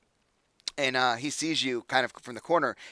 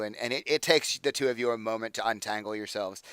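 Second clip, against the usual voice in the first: normal talking voice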